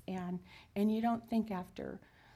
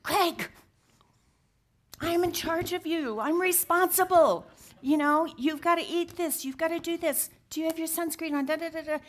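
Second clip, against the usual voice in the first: high, loud nagging orders; mock-scold him like a fussing parent; hammed-up nagging wife; bossy caregiver; 8 semitones higher, 8 dB louder than usual. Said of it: "So much of our relationship has been".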